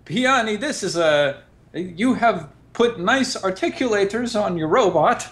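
high, tense compliment; Eric character voice. Acted. Eric to Piani about the robot, high tense voice. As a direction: praise the robot politely but uneasy. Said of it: "I say".

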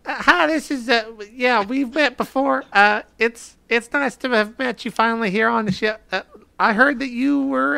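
put-on high voice, halting and polite; high-pitched Higgins. Player as Higgins chatting up a rival by video call, high-pitched. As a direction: talk up high, as if through a voice modulator. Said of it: "Can we get some high pitch, Higgins?".

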